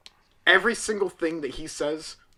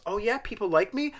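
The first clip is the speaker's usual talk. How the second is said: Mark Wahlberg impression; 7 semitones higher than usual. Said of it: "everything's a question with Mark Wahlberg".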